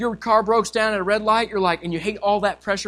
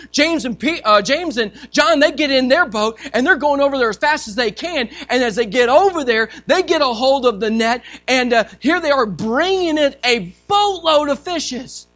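ordinary delivery

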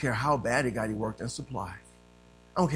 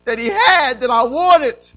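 loud, raised preaching voice; forceful preaching; 11 semitones higher, 15 dB louder than usual. Real, not acted. Preacher telling church of his search for God, forceful preaching.

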